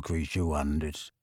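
put-on low, slow croak of an old house elf; Kreacher muttering. House elf muttering to himself, low croak. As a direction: mumble it like a bitter old elf.

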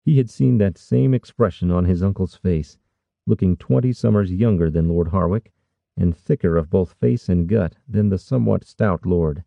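plain reading voice

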